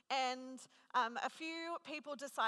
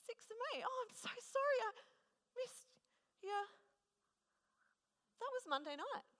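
shocked realisation; 11 semitones higher than usual. Retold or imitated. Realising she missed friend's birthday.

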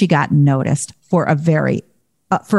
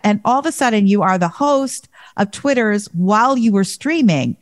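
ordinary talk, nothing marked

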